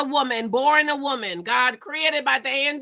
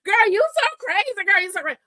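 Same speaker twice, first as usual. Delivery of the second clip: high, loud, fast playful teasing; joking girl talk; 9 semitones higher, 5 dB louder, faster than usual. Retold or imitated. Friends ribbing each other, playful high-pitched teasing. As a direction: tease a girlfriend like it's a joke. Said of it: "in a joking way".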